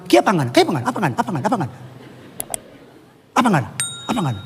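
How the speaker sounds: mock Manado wife's scolding chant. Preacher mimics a Manado wife scolding her husband, Manado accent. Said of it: "Ambon, Manado".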